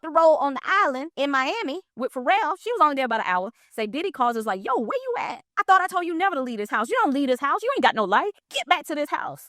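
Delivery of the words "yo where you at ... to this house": high-pitched retold telling-off; mock angry scolding. [name] phones his assistant for leaving the house, mock angry scolding. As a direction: act out an indignant boss checking up.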